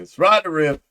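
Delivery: loud, high show-host shout; big hype intro; hyped intro. Acted. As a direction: announce yourself like a rock host.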